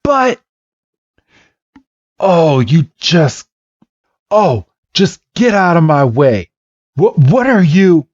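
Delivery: high, sputtering frustration; acted flustered outburst; exasperated. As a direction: push past a stubborn rival. Red's exasperated quarrel with Blue.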